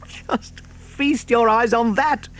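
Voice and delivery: high, slow, gleeful cry; excited cry. A narrator's reading. Mister Fox to his family, excited high cry. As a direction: fox dancing with delight as he calls. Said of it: "cried Mister Fox, dancing up and down".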